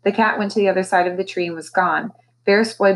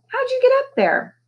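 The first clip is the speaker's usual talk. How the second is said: surprised. A narrator's reading. Amazed girl calls up tree.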